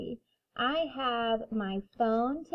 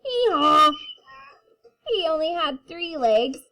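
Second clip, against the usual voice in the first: put-on reading to children; 4 semitones higher, 5 dB louder than usual. A narrator's reading. Reading picture book to kindergarten.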